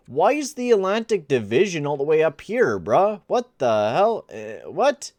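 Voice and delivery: exasperated at the messy menu; baffled outburst. Real, not acted. Confused by the division layout.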